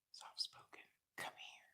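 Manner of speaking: put-on hushed, breathy summons to a child; mother's angry whisper. Mother summoning her child, in an angry whisper. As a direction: whisper the call like a quietly furious mother. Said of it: "Mama's mad".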